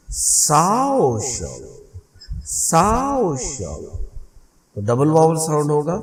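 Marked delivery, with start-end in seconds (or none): 0.1-4.0 slow "साओ शव" with a long, rising "साओ" and a falling "शव"; stress on first syllable. Shown as a demonstration. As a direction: lean on "साओ" in "साओ शव", let "शव" drop. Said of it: "क्योंकि stress इधर है". ("साओ शव" में ज़ोर "साओ" पर, "शव" हल्का)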